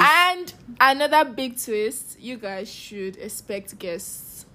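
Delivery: slow, dragged-out announcement; slow teaser. Acted. Teasing listeners about surprise guests, slowly. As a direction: draw it out like a big reveal.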